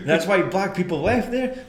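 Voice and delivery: real laughter breaking into the words; incredulous question through laughter; laughing. Real, not acted. Cracking up at own joke.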